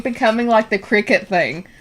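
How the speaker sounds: normal talking voice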